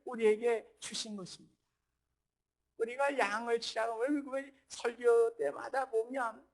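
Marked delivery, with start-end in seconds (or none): none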